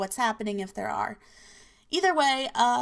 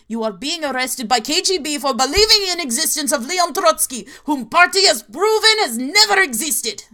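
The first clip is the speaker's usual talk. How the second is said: mock dramatic shout; 5 semitones higher, 10 dB louder than usual. KGB arrest punchline in accent.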